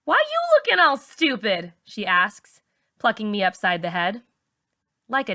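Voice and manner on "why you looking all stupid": high mocking teen girl; teasing friend. A narrator's reading. Char mocks Malika in the school hall, high and sneering.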